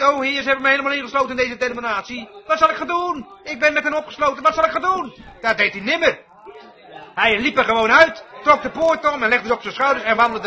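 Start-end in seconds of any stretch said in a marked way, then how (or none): 0.0-5.1 snel, jammerend en hoger; mock paniek. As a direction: doe een paniekerige bidder na. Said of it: "Simpson riep niet". (spottend nagedaan jammeren om hulp)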